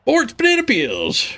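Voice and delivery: high, slow jingle announcer. Pretend. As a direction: sing out the brand name high. Fake sponsor jingle.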